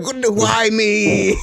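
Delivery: high, slow melodramatic wail; mock overacting. Mocking Jada Pinkett's death scene, melodramatic. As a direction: ham up a dying actress. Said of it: "biggest overacting".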